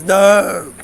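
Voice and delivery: loud, drawn-out mock "no"; mock complaint. Imitating fans complaining about a new Sonic voice, loud mock protest. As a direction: cry out like an outraged fan. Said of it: "everyone, like, complains about it".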